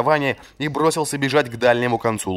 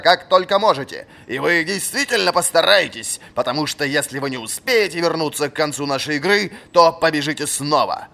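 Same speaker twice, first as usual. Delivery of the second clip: put-on stern old master; 8 semitones higher than usual. A narrator's reading. Old fencing master ordering a punishing run. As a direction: lay out the punishment with relish.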